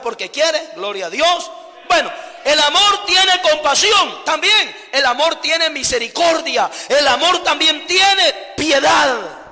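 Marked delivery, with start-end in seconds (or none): 1.9-9.4 slow, loud, high preaching shout; full-force preaching. Acted. Preacher crying out to the congregation, slow and loud. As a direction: drag out each phrase like a revival sermon.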